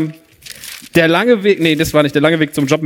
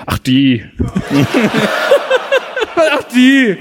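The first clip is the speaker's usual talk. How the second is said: dismissive doctor; 6 semitones higher, much slower than usual. Retold or imitated. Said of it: "was hat denn Ihre Sprechstundenhilfe erzählt".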